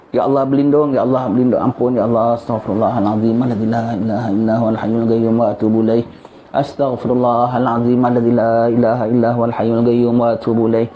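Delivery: low, murmured repentance prayer; closing prayer. Real, not acted. Preacher leads the congregation in istighfar, low devout murmur.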